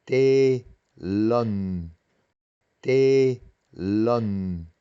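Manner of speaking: "Telón" with a long first E, two separate syllables; long double vowel. Shown as a demonstration. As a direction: stretch the E in "Telón" to double length. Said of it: "pero se pronuncia doble".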